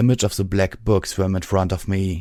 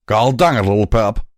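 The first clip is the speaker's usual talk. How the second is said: louder, cross southern growl; angry growl; 2 semitones higher, 5 dB louder than usual. A narrator's reading. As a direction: growl it back, mildly angry. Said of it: "Calamity growled back angrily".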